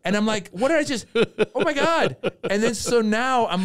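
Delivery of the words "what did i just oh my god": very high-pitched mock dismay; comic panic. Comedian realises what he agreed to, high comic panic. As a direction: spiral into exaggerated regret.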